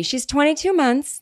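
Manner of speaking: put-on like a parent telling someone their kid's age in months; mock proud parent. Teasing listeners with a lightly voiced proud parent. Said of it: "I'm starting to talk like someone who has a baby".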